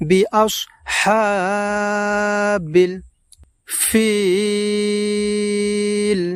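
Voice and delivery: বিআসহাবিল with the হা held long; long vowel drawn out. Shown as a demonstration. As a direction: stretch the হা in বিআসহাবিল slowly. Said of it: "টান দিয়ে লম্বা উচ্চারণে এইভাবে পড়ব". (বিআসহাবিল, হা লম্বা টান দিয়ে)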